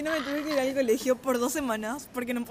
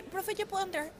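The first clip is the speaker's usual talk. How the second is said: imitated sweet child; 8 semitones higher, 5 dB quieter than usual. Child asking teacher sweetly.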